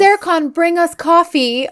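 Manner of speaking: high, chirpy request, imitated; sudden change of tune. Woman now welcoming the teacher asks for coffee, high and eager. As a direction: call it out high as the suddenly gracious hostess. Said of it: "The way her tune changes so quickly".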